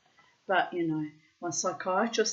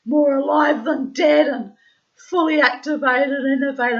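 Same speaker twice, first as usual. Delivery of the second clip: louder, higher, wired and revved up; manic energy; 7 semitones higher, 10 dB louder than usual. Retold or imitated. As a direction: sell the buzzing zombie high. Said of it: "I'm a bit fucking scary when I get like that".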